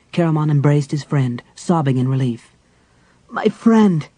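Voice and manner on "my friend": tearful warrior. Acted. Caramon embraces Tanis.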